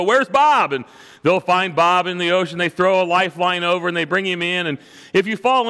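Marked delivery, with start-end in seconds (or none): 0.0-0.7 alarmed call. Retold or imitated. Crew calling out for missing man.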